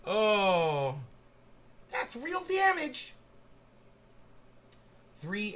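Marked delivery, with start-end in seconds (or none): 1.9-3.1 dramatic high catchphrase. Pretend. Mock commentary on dog fight.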